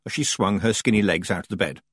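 usual speaking voice

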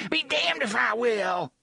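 defiant high shout. A narrator's reading. Defiant refusal.

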